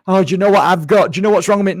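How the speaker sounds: high, loud, fussing imitation; mock complaining ego. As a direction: play up the self-absorbed moaning. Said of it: "the fears and the ego".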